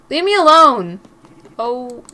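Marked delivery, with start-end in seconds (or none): 0.1-1.0 loud, higher-pitched cry to be left; angry outburst. Acted. Narrator fleeing the prank quarrel, loud upset outburst. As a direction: burst out loudly, pitch jumping up.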